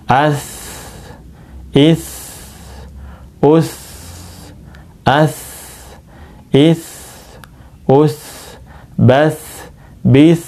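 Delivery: "As" with a long hissing s; flowing sukun s. Shown as a demonstration. Teacher drilling students, "As" with a flowing s. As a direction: let the s in "As" flow out. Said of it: "ini mengalir".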